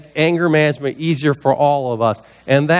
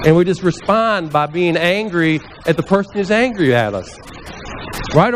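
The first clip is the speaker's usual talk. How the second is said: ordinary talk, nothing marked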